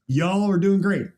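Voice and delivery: imitated Southern twang, drawn slow; Southern accent. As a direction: put on a friendly Southern accent, stretched out. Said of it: "Southern accents".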